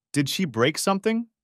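put-on a small girl wondering aloud; little girl asking. A narrator's reading. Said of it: "asked Nancy".

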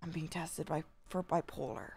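low, hushed confession; serious secret. Real, not acted. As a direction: confide it softly, with halting pauses. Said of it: "it's a very serious secret".

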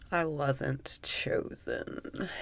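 slow whispered confession. Acted. Admitting disappointment in a slow whisper. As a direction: breathe the words out slowly.

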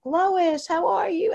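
high, sing-song hello; cheery greeting. Retold or imitated. Meeting member spotting Lois, calling a high cheery hello. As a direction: greet her delightedly across the room. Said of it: "it's like".

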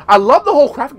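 loud, fast gush of enthusiasm; sudden loud burst of delight; excited. Real, not acted. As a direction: blurt out how much you love it. Excited gushing about crafting.

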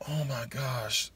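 ordinary talk, nothing marked

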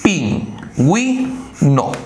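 pingüino whispered slowly, with the u sounded as gwi. Shown as a demonstration. Teacher whispers pingüino to show the diéresis. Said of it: "Acá dice".

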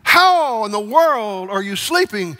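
shouting in panic over the storm; panicked disciples. Acted. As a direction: plead like men about to drown.

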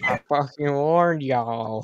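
slow loud southern drawl; fake southern accent. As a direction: stretch every word, Southern style.

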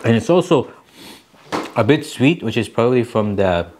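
chewing between words; muffled by food; mouth full of noodles. Real, not acted. Seen 1: Bites noodles just before; lips and cheeks look full while talking early.

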